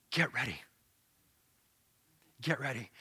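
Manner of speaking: low, quiet call; a soft low prophetic murmur; hushed voice. Acted. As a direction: say it low and hushed, spaced apart. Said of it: "a voice that says".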